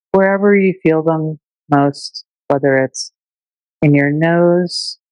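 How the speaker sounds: unhurried meditation teacher voice with long pauses; calm, measured breathing-meditation delivery; guided meditation. Shown as a demonstration. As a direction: guide listeners gently through their breath, leaving space between phrases.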